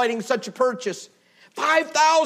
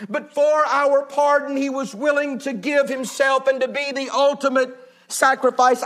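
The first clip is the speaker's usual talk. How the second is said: usual speaking voice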